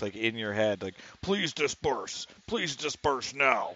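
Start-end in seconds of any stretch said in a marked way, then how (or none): 1.2-3.7 booming loudspeaker voice. Retold or imitated. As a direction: boom it out like a police dish. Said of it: "this is booming voice".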